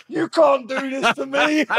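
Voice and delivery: squeaky high mock whine, slowed; mock whiny protest. Prisoner carried off by guards, high mocking whine. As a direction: whine it like a helpless kid being dragged off. Guards carrying him to block.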